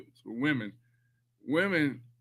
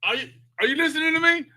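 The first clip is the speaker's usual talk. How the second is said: high, fast mom demanding attention; 8 semitones higher than usual. Retold or imitated. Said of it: "I'm like".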